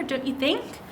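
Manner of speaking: ordinary delivery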